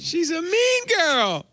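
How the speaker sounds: high, slow sing-song teasing; playful high-pitched taunt; mock girly. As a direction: squeal it out like a teen girl. Teasing after Mean Girls bit.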